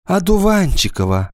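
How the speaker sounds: high-pitched, stricken call; a touch of despair, pitched high; crushed voice. A narrator's reading. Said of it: "сказала убитым голосом Нина Петровна".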